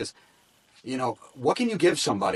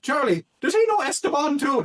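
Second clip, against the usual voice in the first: bartender voice; 5 dB louder than usual. Retold or imitated. Bartender retold at the pub.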